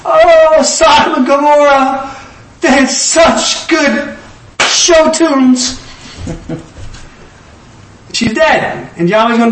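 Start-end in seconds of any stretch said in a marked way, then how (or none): 0.1-5.8 much higher and louder, then slow and drawn out, hammed-up longing; mock-wistful sigh for the lost city; Lot's wife longing. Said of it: "like Lot's wife looking back".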